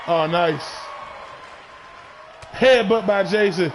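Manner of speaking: steady ringside call, punchy on each move; live play-by-play. Acted. Commentator calling the match for his viewers, play-by-play. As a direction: call every hit like a ringside announcer.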